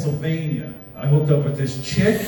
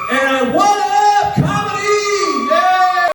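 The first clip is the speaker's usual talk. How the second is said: shouted cheer; 3 dB louder, much slower than usual. Real, not acted. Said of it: "shouted, sung cheer".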